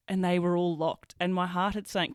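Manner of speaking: usual speaking voice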